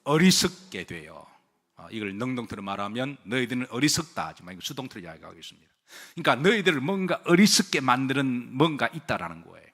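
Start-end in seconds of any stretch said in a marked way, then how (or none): none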